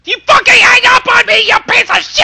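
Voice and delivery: high, loud raging yell; loud angry screaming; furious shout. Real, not acted. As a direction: scream insults in real fury. Angry outburst at caller.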